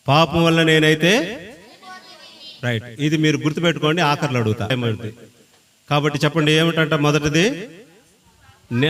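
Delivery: usual speaking voice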